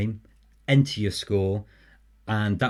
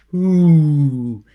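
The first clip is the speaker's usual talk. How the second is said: high loud spooky ghostly ooh; ghost moan; 5 semitones higher, 11 dB louder than usual. Pretend. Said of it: "That's my ghost impression".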